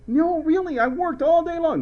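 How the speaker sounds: high-pitched put-on teen protest; protesting son. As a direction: whine it like a kid who swears he worked. Mark insists to his dad.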